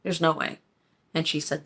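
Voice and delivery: ordinary delivery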